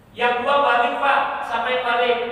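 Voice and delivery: high, loud call; preaching shout. Acted. Preacher telling the congregation who goes home, loud and high. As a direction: proclaim it to the hall.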